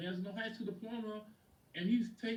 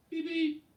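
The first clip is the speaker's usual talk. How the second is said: loud, high-pitched beep-beep; car horn imitation; 9 semitones higher, 7 dB louder than usual. Client honking outside for the coach, loud mock horn. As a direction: sound a cheery horn from outside.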